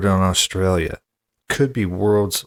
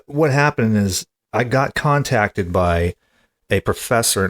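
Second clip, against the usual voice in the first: normal talking voice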